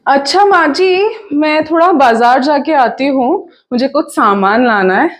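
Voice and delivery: loud, quick, lively stage voice; chatty daughter-in-law. Acted. Daughter-in-law telling mother-in-law she's off to the market, chatty. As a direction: project it briskly, as if calling to the household.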